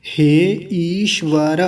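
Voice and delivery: ordinary talk, nothing marked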